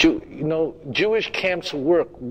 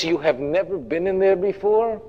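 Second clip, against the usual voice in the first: ordinary talk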